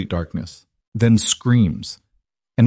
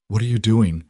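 usual speaking voice

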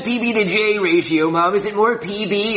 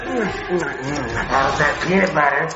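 creepy clown voice; 5 semitones lower, much slower than usual. Acted. Pennywise voice taunting.

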